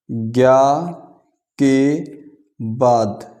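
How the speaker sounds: each word set apart by pauses; slow dictation. Real, not acted. Teacher dictating notes to students, word by word. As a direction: dictate firmly, a word at a time. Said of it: "इसको यहाँ भी लिख लो".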